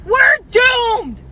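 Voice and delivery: loud, high cry of despair; exasperated shout. Real, not acted. Venting to the camera about men, loud exasperated shout. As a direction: yell it out in disbelief.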